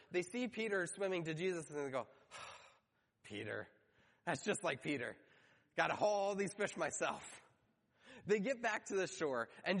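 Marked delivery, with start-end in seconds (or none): 2.3-7.4 sigh, then grumble as if left with the work; exasperated grumble. Retold or imitated. Disciples watching Peter swim off, mildly exasperated.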